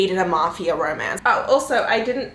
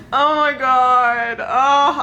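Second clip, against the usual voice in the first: loud, slow, high-pitched cry; drawn-out swooning exclamation, louder and higher; overwhelmed gushing; 4 semitones higher, 6 dB louder, much slower than usual. Real, not acted. Reader in love with the book, gushing loudly.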